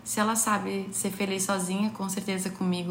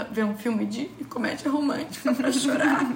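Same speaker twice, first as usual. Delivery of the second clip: rindo; 5 semitones higher than usual. Real, not acted. Rindo do próprio exemplo.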